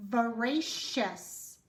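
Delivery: "voracious" said slowly; slow demo word. Shown as a demonstration. Teacher presenting the word slowly. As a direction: draw out "voracious". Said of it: "Next word is".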